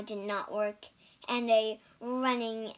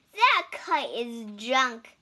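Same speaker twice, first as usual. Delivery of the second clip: louder, scornful robin voice; robins scoffing; 8 dB louder than usual. A narrator's reading. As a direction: sneer at the kite loudly, like a robin. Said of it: "said the robins".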